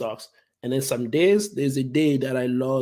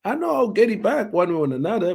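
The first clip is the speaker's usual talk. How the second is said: much higher, amused, unbothered comeback; retold nonchalant retort; 8 semitones higher, 4 dB louder than usual. Speaker answering brother's shock, higher and carefree. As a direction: brush off the worry with a high laughing retort. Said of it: "I'm like".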